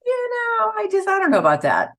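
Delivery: high, skeptical hedge; doubtful reply. Retold or imitated. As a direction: sound unsure and a little amused. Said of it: "and I said".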